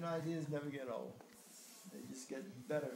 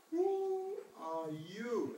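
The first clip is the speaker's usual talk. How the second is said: slow, wondering question; 7 semitones higher, 4 dB louder, much slower than usual. Acted. Poem's question, drawn out.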